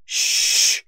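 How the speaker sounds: sharp loud unvoiced shush. A narrator's reading. Librarian shushing the loud detective, sharp shush. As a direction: hush him sharply.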